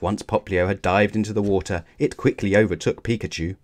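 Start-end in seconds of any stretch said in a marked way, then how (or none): none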